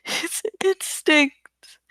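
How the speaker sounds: ordinary delivery, nothing marked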